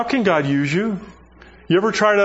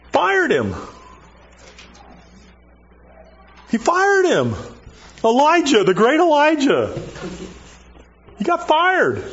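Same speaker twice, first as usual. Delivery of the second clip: emphatic punchline; 11 semitones higher than usual. Real, not acted. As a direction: land the surprise hard. Preacher's punchline, God fired Elijah.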